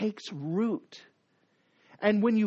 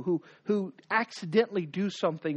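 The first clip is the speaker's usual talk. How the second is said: ordinary delivery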